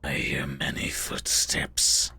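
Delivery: low whisper in character; very quiet warning. Acted. Player's character reports footsteps to the party, very quiet whisper. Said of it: "I very quietly say".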